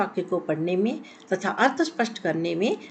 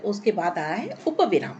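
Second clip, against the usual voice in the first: usual speaking voice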